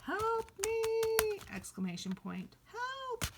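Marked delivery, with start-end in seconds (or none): 0.0-1.4 very high, loud mock plea; drawn-out squealed plea; exclaimed cry for help. Shown as a demonstration. Teacher shows exclamation point, exclaimed cry. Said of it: "might use an exclamation point for". 2.7-3.2 very high-pitched mock cry; exclaimed cry for help. Shown as a demonstration. Teacher shows exclamation point, exclaimed cry. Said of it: "might use an exclamation point for".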